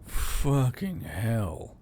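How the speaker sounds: a slow, drawn-out groan of dismay. A narrator's reading. Soldier hearing bad news from the governor, groaning. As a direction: drag the curse out in weary dismay. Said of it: "Yule groaned".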